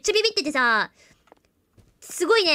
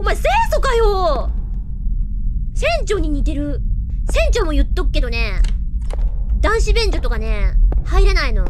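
higher and louder, gleeful teasing; excited loud taunting; 4 semitones higher, 7 dB louder than usual. Real, not acted. Streamer taunting the ghost from the men's toilet, loud gleeful teasing. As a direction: crow at the ghost who can't follow.

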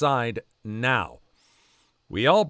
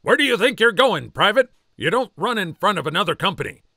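high, loud barking drill sergeant; loud mock drill sergeant yell; 7 semitones higher, 5 dB louder, faster than usual. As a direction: bark it at a running private. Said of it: "says in drill sergeant voice".

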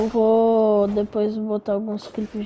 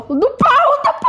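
mock gibberish outburst; 5 dB louder, slower than usual. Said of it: "só falei em árabe".

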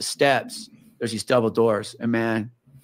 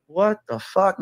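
alarmed; 7 semitones higher, slower than usual. Retold or imitated. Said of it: "I'm going like".

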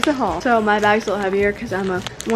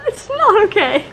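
mock offended; 11 semitones higher, 5 dB louder than usual. Mock scolding after side-eye.

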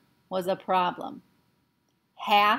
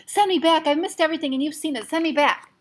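high, loud insistent plea; pleading demand; 8 semitones higher, 5 dB louder than usual. Acted. Patrick demanding Omri send him back, loud. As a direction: beg hard to be sent.

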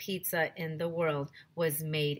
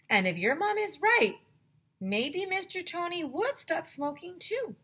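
excited; 11 semitones higher than usual. Acted. AJ excitedly pitching his idea.

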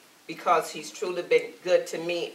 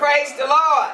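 higher, louder call to praise; 7 semitones higher, 6 dB louder than usual. Real, not acted. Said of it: "Let's try this again".

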